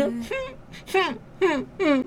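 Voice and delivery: soft, high little sobbing whimpers; small fake cry. Shown as a demonstration. Bride crying a little for her parents, token fake sobs. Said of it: "你小哭一下".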